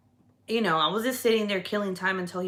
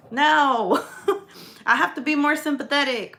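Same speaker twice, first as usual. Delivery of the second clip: laughing; 7 semitones higher, 4 dB louder than usual. Real, not acted. Laughing at own joke.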